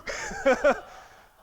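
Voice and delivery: high, loud burst of laughter; real laugh. Real, not acted. Preacher laughing at her own joke.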